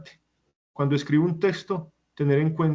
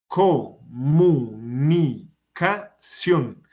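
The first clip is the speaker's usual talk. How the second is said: comunicación very slowly, co-mu-ni-ca-ción, each beat apart; syllable by syllable; 3 dB louder, much slower than usual. Shown as a demonstration. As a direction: break comunicación into separate, drawn-out syllables. Teacher splits word into syllables. (comunicación sílaba por sílaba, muy despacio)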